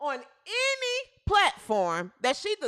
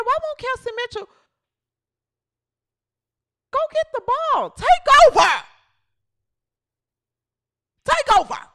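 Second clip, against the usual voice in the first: higher, louder yell at the screen; mock fan yelling at the game; retold yell at TV; 8 semitones higher, 7 dB louder than usual. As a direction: shout at the screen in disbelief. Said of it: "and I said".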